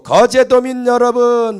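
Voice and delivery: put-on slow, loud address in a Gyeongsang accent; booming Gyeongsang greeting to the islanders. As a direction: open a rally speech like a Gyeongsang politician. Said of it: "경상도 말로 한 겁니다".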